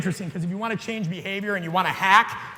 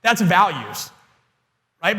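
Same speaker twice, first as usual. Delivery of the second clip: usual speaking voice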